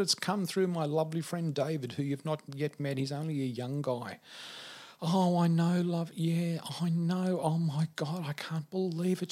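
high, emotional phone voice; retold tearful excitement; imitated laughing and crying. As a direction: act out her overwhelmed disbelief. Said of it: "And she's laughing and crying".

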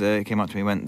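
ordinary talk

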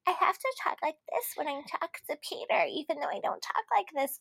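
high, soft put-on valley girl voice. Co-host mimics how contestant talks to Peter, valley girl voice. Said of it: "kind of voice thing".

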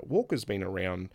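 ordinary talk, nothing marked

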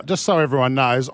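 ordinary delivery

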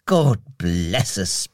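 slow, low blessing from a father; beaming Bob. A narrator's reading. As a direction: beam contentedly, low and unhurried. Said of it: "beamed Bob".

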